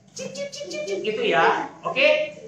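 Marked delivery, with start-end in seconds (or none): 0.1-0.7 very high, soft chirping cip; bird chirps. Shown as a demonstration. Leader showing kids the bird sound, high chirping. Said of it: "tahu nggak suaranya gimana".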